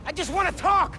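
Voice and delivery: loud, high shout across distance; shouted plea. Acted. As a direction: yell it so she hears. Shouting after Phin mid-chase.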